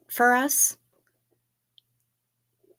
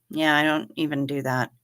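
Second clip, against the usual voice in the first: ordinary talk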